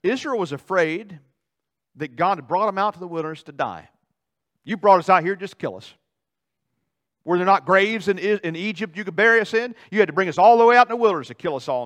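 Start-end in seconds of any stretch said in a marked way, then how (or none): 4.6-11.8 loud, accusing protest; angry complaint. Acted. Israel accusing God of dragging them out to die, loud and angry. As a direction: hurl the blame at God.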